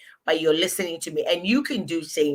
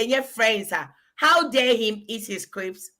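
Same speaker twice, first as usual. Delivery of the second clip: ordinary talk, nothing marked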